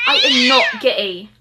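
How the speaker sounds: high, loud, drawn-out shriek; indignant denial. Acted. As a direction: shriek the denial slowly, hammed-up. Character hotly denies being smitten.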